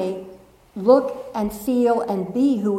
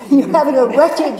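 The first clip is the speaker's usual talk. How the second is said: put-on mother's English accent, higher and louder; 4 semitones higher, 8 dB louder than usual. Speaker quoting mother's favourite word, English accent. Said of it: "she'd say in an English accent".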